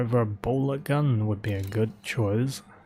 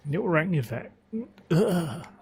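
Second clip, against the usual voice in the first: voice cracking; 7 semitones higher than usual. Real, not acted. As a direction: voice cracks and breaks up high. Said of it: "I lost my voice just now".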